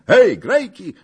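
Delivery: high, loud hail to the musicians; shouted call. A narrator's reading. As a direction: call the band over. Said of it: "zakrzyknął na muzykę". (głośne zawołanie)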